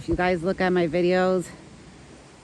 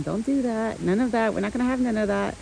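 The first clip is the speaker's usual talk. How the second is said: ordinary delivery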